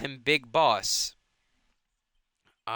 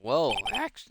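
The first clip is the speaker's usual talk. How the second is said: voice crack. Real, not acted. Player reading the lawyer's reply, real accidental voice crack. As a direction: voice cracking mid-word. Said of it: "that was a voice crack".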